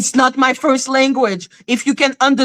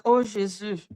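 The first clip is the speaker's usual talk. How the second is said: low, soft "oh Jésus" under the breath; dismayed aside; 5 semitones lower, 10 dB quieter than usual. Retold or imitated. Reacting to their bad French, low soft dismay. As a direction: let it drop, appalled and quiet. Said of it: "I'm like".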